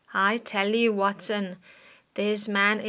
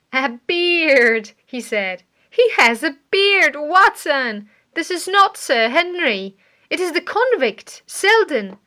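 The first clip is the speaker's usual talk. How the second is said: higher, louder relieved exclamation; excited discovery; 5 semitones higher, 8 dB louder than usual. A narrator's reading. Holmes realises the dead man is not Sir Henry, excited relief. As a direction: burst out with the realisation. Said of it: "Suddenly Holmes laughed".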